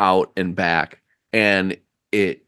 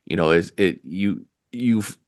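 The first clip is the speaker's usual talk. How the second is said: ordinary talk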